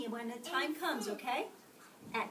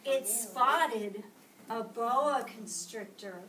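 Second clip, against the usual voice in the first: ordinary delivery, nothing marked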